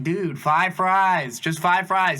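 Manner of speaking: slow, loud, high "five fries" spelled out; fed-up worker repeating the order, slow and loud; exasperated repeat. Retold or imitated. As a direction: hammer the order home to a spaced-out cook. Repeating order to stoned cook.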